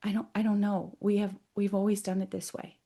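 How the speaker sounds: soft, hesitant reply. Retold or imitated. The energy admitting it has always done this, very quiet. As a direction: answer very quietly, subdued. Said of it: "got really quiet, even more quiet".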